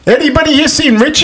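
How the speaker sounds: high-pitched challenge to the whole room; mock tough cop. Seagal asking the bar after Richie, hammed-up challenge. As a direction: call it out high, like a movie cop.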